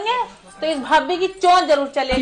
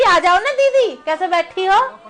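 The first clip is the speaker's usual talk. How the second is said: sweet invitation; 4 semitones higher than usual. Retold or imitated. Aunt mimics the sister-in-law sweetly calling her own sister. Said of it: "अपनी बहन को कहती".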